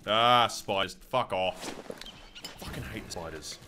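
ordinary delivery

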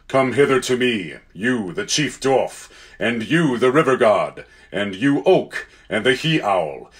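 measured, weighty summons; name each one deliberately, then darken; Aslan, solemn ruler. Acted. Lion summoning his council against evil, solemn and stately.

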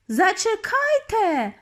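high, drawn-out cry; stretched high witch's call; exclaiming. A narrator's reading. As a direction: call out long and high to stop them. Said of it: "вигукнула вона знову".

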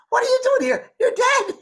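very high, loud mock shock; shocked Scottish lady. Spirit lady shocked to see her dead sister, loud high imitation. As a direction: exclaim as the imitated lady, pitch shooting up. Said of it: "a lady from Scotland".

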